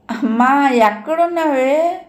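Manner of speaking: put-on high, drawn-out child's whine; plaintive crying call, acted, climbing in pitch; tearful calling. A narrator's reading. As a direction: wail for your missing mother. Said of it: "అన్నాడు ఏడుపులోనే".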